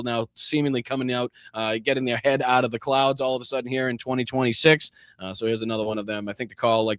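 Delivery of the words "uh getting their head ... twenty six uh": normal talking voice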